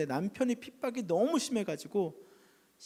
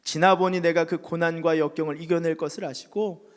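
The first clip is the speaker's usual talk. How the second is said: preaching at full force; 10 dB louder than usual. Acted. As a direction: proclaim it to the hall. Preaching climax. (힘차게 설교하는 목소리)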